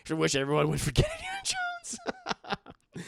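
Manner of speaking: laughing through the words. Real, not acted. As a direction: read it while laughing. Laughing reading chat.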